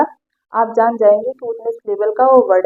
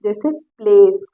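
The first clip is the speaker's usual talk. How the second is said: usual speaking voice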